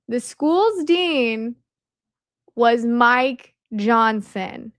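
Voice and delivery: "was Mike Johnson" very slow, each word separated; dramatic reveal. Real, not acted. Speaker reveals the school's dean to viewers, dramatic slow reveal.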